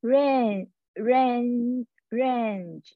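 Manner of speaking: range drawn out slowly with a long vowel; teaching the long vowel in range; slow careful range. Shown as a demonstration. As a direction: stretch each range slowly. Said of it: "是range".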